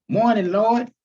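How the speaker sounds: a praying grandmother starting her morning prayer; old grandmother. Acted. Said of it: "Grandma Rogers".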